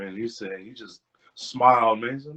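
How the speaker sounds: usual speaking voice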